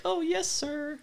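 high, far-off servile voice; groveling underling. Retold or imitated. Friend mocking underlings bowing to their manager, high servile squeak. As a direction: simper up at the boss like a lackey. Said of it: "And they're like".

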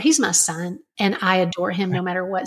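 ordinary delivery

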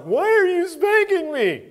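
put-on high, loud whiny kid voice; imitating a crying child. Dad retelling his son's protest, in a high loud child voice. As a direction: squeal it like a hurt little boy. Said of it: "He's like".

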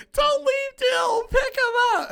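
very high, slow, shouted pleading; mock childish wail. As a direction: wail it like a crying five-year-old. Child crying at movie, pleading.

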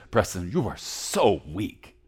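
slow, belittling put-down; a hint of a scornful voice, slow; mock harsh accuser. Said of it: "he doesn't say".

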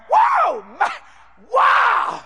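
high-pitched amazed exclamations; acted-out awe, high and ringing; excited outburst. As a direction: burst out in wonder, pitch leaping up. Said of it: "I got excited".

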